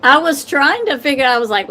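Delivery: normal talking voice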